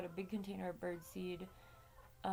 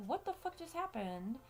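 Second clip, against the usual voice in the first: alarmed disbelief; 8 semitones higher than usual. Retold or imitated. Startled by dog scream, retold.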